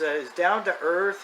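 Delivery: usual speaking voice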